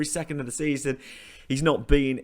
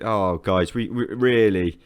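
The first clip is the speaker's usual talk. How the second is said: normal talking voice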